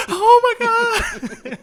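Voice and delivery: mock fangirl shriek, high and very loud; starstruck squeal. Mimicking fans gushing over a celebrity, hammed-up starstruck squeal. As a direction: build to a drawn-out, hammed-up squeal. Acting out gushing fan freak-out.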